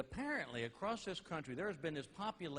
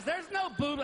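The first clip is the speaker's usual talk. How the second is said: put-on kid's voice; 13 semitones higher, 7 dB louder than usual. Son's reply voiced in story.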